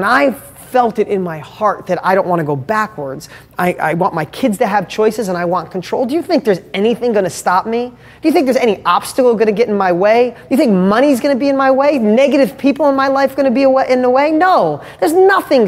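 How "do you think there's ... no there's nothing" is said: rising rhetorical questions, building to an emphatic no; fired-up defiance. Real, not acted. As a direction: pitch climbing with each challenge.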